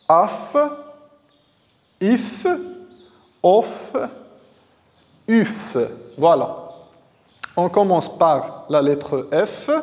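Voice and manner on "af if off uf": "Af, if, off, uf" one at a time, long pauses between; rereading syllables. Shown as a demonstration. Teacher rereads the new syllables for pupils, separated syllable drill. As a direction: sound out "Af, if, off, uf" as isolated syllables with a released f. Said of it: "on va relire".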